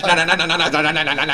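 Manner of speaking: rapid repeated nah; machine-gun stutter; mock stutter. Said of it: "He be like".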